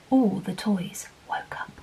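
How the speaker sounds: soft suspenseful whisper; hushed storytelling. A narrator's reading. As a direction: whisper it like a secret. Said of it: "storyteller builds suspense".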